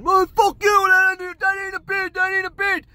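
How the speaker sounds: very high, loud screamed protest; screaming. Retold or imitated. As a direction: imitate an outraged bidder screaming back. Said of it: "my guy starts screaming".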